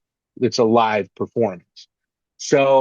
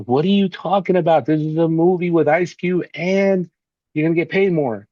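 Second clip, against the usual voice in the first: agent getting mad; 6 semitones higher than usual. Retold or imitated. Agent scolding him for turning down the film, mildly raised. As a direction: push back at him, mildly worked up. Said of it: "she was getting mad".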